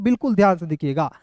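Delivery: normal talking voice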